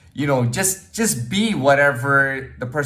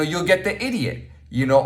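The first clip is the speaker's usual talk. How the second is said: normal talking voice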